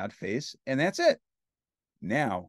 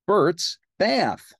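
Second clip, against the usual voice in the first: ordinary delivery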